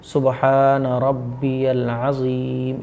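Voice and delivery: सुबहान रब्बियल अज़ीम very slow, ज़ clearly buzzed; slow, clear recitation. Shown as a demonstration. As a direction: model अज़ीम with a real z sound. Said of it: "इस तरीके से". (अज़ीम में 'ज़' साफ़, बहुत धीरे)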